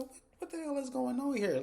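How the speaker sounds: shocked high-pitched question; startled outburst. Retold or imitated. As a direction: blurt it out in alarm. Startled by Leslie staring.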